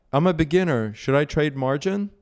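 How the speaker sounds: high-pitched imitation of a naive questioner; mocked clueless beginner. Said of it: "Like the clown that asked me".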